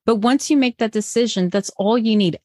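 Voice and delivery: normal talking voice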